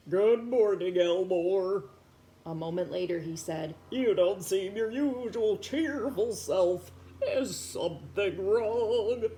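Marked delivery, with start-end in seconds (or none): 0.1-1.8 louder, kindly uncle voice; old uncle. Acted. Old uncle porcupine greeting his nephew, kindly. 3.9-9.2 put-on slow, kindly old uncle; concerned uncle. Uncle checking on sad Elmore, slow and kind.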